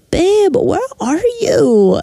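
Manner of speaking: put-on sing-song drunken sweetness; drunk sweet call. Drunk friend phones the narrator, sweet and slow.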